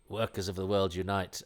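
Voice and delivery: usual speaking voice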